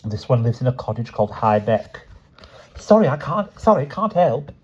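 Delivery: a touch of icy dismissal; cool aristocratic voice; cold posh girl. A narrator's reading. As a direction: brush her off. Girl continues coldly.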